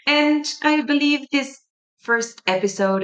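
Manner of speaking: ordinary talk, nothing marked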